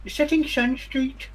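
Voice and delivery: high-voiced local with too many teeth; toothy townsperson. Townsperson explaining the street name to visitors, high toothy character voice. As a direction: play a toothy local, pitched well up.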